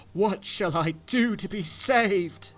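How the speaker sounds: high, slow desperate plea; a lost man's slow, high plea for rescue; anguished cry. Acted.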